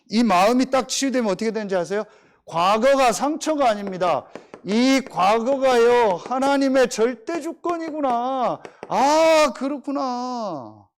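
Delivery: slow, rising sermon peak, drawn out; emphatic pastor's revelation; preaching. Acted. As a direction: preach it slowly, building to the realization. Preaching climax on healing.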